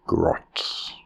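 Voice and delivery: breathy whisper of Grots. A narrator's reading. Narrator whispers the name.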